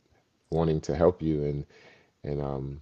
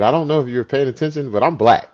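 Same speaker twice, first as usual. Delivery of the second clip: higher, louder comic objection; mock protest; 9 semitones higher, 9 dB louder, faster than usual. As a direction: point out the obvious to a buddy, loudly. Retold protest to his friend.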